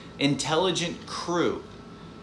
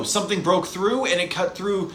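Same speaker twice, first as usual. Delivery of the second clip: usual speaking voice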